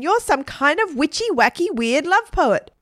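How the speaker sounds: high, singled-out insult; name-calling taunt. A narrator's reading. Stephen calls Margaret names, taunting. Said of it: "Stephen calling her a witchy-wacky love poet".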